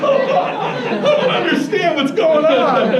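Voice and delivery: loud, high, drawn-out bewilderment; hammed-up helpless confusion; baffled exasperation. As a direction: wail it slowly in comic disbelief. Baffled narrator acts out exasperation.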